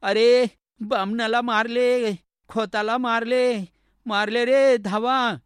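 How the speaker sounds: loud, high, slow cry for help; old woman's shout for help. Acted. Old woman yells for help as the Brahmin is attacked, loud high cry. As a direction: scream it out so the village hears. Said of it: "म्हातारीने ओरड केली".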